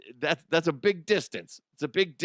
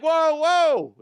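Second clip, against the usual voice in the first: high, loud alarmed whoa; loud startled cry; 9 dB louder than usual. Retold or imitated. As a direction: jump back in alarm. Axe pulled out, startled.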